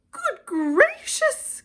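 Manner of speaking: very high, slow, loud gasp; gasping in surprise. A narrator's reading. Maisie startled to recognise Horton, gasping. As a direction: gasp it out, shocked. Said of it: "gasped Maisie".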